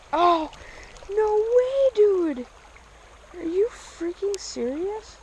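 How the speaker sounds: high, drawn-out disbelief; real amazement. Real, not acted. Angler has just hooked a fish, astonished. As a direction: cry out high, stunned by the catch.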